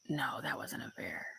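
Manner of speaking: whispered, talking herself down; whispered self-reassurance. Retold or imitated. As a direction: hush it to yourself to stay calm.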